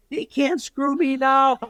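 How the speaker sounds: high, drawn-out retold wail; mock despairing panic. Reporter fretting in the storyteller's ear on deadline, despairing.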